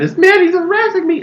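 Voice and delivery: high, loud imitation of an upset caller; mock near-tears. Woman phoning police about a man, mock near-tears. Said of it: "like she's about to cry".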